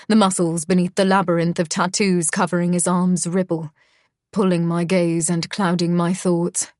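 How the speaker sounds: usual speaking voice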